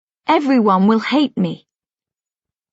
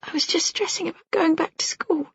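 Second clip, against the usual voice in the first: mumbling; 7 semitones higher, 7 dB quieter than usual. Acted. Mumbling evasive answer.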